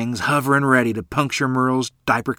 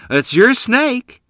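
laughing; 14 semitones higher, 9 dB louder, much slower than usual. Acted. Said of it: "Mister Hamilton laughed".

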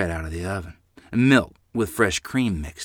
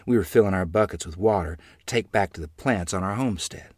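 ordinary delivery, nothing marked

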